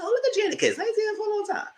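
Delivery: high, sing-song surprise; mock surprise. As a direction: greet him in a high teasing voice. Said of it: "I said".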